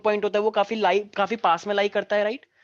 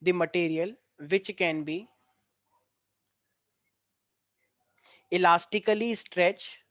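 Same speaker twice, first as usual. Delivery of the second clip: each phrase spoken very slowly for students to copy; slow, clear classroom dictation; much slower than usual. Shown as a demonstration. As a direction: dictate word by word with long pauses. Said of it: "लिखो सारे बच्चे".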